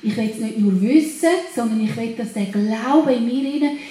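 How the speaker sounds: ordinary talk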